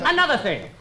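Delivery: ordinary talk, nothing marked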